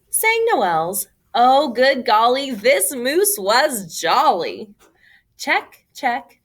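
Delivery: ordinary delivery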